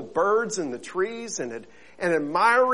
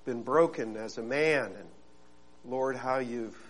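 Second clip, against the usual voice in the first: soft, lower prayerful voice; 6 semitones lower, 10 dB quieter than usual. Acted. Pastor praying softly before his sermon. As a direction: pray quietly and humbly before the congregation.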